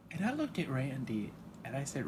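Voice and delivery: normal talking voice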